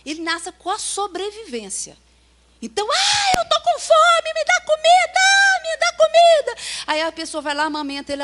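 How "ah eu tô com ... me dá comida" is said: put-on high, loud whiny baby demanding food; squealing baby voice crying for food; hungry baby voice. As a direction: wail like a hungry infant.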